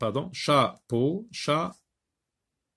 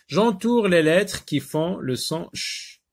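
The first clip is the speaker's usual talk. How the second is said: higher, louder task announcement; instruction read aloud; 7 semitones higher, 6 dB louder than usual. Shown as a demonstration. Teacher announcing the next exercise, raised voice.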